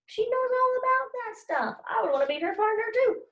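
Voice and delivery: excited. Real, not acted. Teacher muses excitedly, high.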